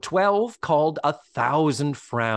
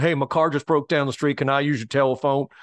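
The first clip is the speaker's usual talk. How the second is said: quick, lightly put-on Southern accent; a stranded guy with a Southern accent asking politely; faster than usual. Stranded driver asks to use the phone, light Southern accent. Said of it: "a guy with a Southern accent".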